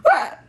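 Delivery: short high yelp; quick, piercing cry of surprise; startled scream. Acted. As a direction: let out a sudden high "ah". Sudden yelp in a skit.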